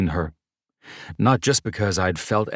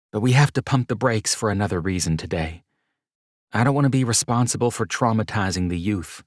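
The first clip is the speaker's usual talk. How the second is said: higher woman's voice, playful and a bit quick; Mercedes, teasing; 4 semitones higher than usual. Acted.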